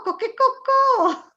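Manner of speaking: high, crowing cochecocò; playful rooster call; Japanese rooster crow. Shown as a demonstration. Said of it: "per i giapponesi fa cochecocò".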